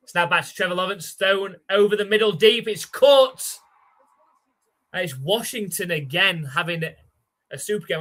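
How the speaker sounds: lively sportscaster calling the snap; play-by-play call. Acted. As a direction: call the play like a TV announcer, building as the ball flies. Live play-by-play calling the game.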